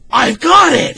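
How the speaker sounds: high, loud shout of a brilliant idea; eureka shout. Retold or imitated. A fan voices Homer shouting his big idea. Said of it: "and he goes".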